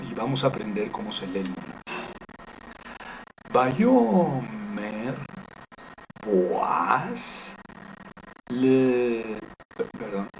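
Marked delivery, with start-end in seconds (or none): 3.5-9.4 drawn-out syllables with sustained vowels; careful, stretched-out demonstration reading; slow Hebrew reading. Shown as a demonstration. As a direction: read it out for learners, holding each syllable. Reads Hebrew verse syllable by syllable.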